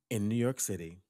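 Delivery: ordinary delivery, nothing marked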